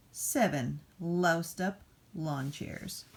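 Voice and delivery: normal talking voice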